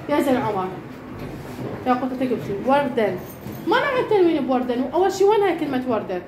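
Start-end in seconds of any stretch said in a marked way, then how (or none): none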